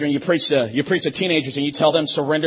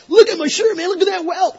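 much higher, excited show-off voice; excited bragging; 6 dB louder than usual. Retold or imitated. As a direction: brag about the welt like it's a trophy. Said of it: "those guys came back".

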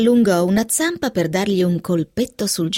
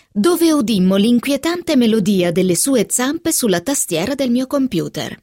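usual speaking voice

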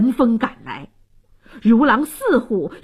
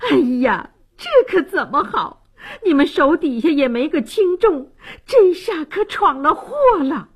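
high-pitched anxious fretting; flustered complaining; 5 semitones higher than usual. Acted. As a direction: wail that disaster has struck, pitch climbing. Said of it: "不禁连声抱怨道".

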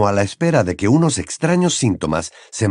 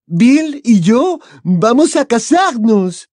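put-on slow, high, loud French-accented gush; a delighted French girl; 14 semitones higher, 5 dB louder, slower than usual. Fleur announcing engagement.

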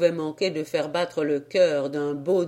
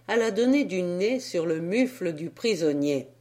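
plain reading voice

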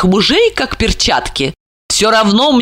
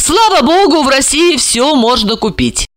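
triumphant; 7 semitones higher than usual. A narrator's reading. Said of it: "торжествуя сообщила Марина".

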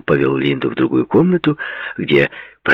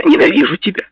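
put-on loud, very high girl's cry; angry outburst; 6 dB louder, faster than usual. A narrator's reading. Jilted girl throws her hatred at Paul, loud and angry. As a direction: hurl it out in hurt fury. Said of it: "сердито спросила она".

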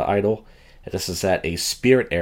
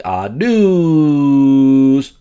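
segment announcer voice; 5 semitones higher, 8 dB louder than usual. Pretend.

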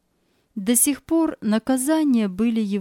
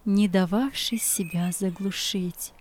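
ordinary delivery

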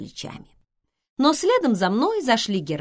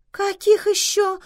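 very high, louder, put-out question; displeased query; 6 dB louder than usual. A narrator's reading. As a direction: recoil in distaste at the news. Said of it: "сморщилась Вивиан".

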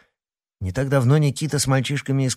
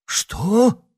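startled high "what?"; surprised; 11 semitones higher than usual. A narrator's reading. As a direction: jump up in pitch, caught off guard. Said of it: "Сенсей застыл от удивления".

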